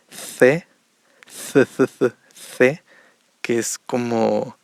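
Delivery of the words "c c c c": "C" as "the", tongue between the teeth; louder th sound for "C", as in English think; Spanish C. Shown as a demonstration. Teacher showing how Spain says it, louder lisped "C". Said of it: "meter la lengua entre los dientes".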